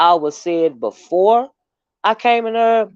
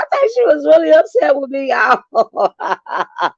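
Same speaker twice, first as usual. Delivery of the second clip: high voice cracking with laughter; laughing till it sounds like crying; laughing so hard it breaks; 9 semitones higher than usual. Real, not acted. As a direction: squeeze it out through tears of laughter. Cracking up telling story.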